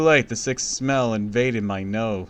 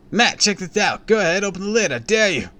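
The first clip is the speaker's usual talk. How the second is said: higher, louder teasing dare; daring kid; 10 semitones higher, 6 dB louder than usual. A narrator's reading. Neighbor kid daring Matt to open the can, high. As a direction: goad him to open it.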